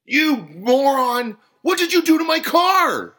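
very high, loud furious yell; outraged, full-voiced shouting; angry customer. A narrator's reading. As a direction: scream at the one who did it. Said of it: "the voice of the angry customer".

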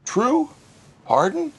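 puzzled high "True?" rising in disbelief; baffled caller, pitched high; confused customer on the drive-thru speaker. Acted. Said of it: "came a confused voice".

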